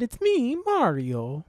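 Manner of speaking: high-pitched cartoon catchphrase voice; game mascot's high, sing-out greeting; Mario. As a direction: call it out high, like the video game plumber. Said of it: "a full-on Mario voice".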